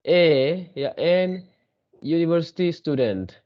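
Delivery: each word read out slowly; slow model sentence. Shown as a demonstration. Teacher reading an example sentence slowly to students. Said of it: "तो यहाँ देखिए".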